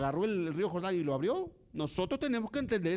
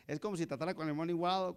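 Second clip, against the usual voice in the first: usual speaking voice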